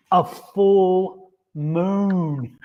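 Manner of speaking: very slow, drawn-out joke delivery; Leslie Jordan punchline. Acted. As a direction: stretch out each word for comic effect. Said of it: "steal that from Leslie Jordan".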